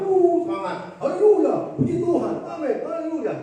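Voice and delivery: high, hammed-up excitement; mock zealous believer. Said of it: "Orang Kristen kebanyakan di awal".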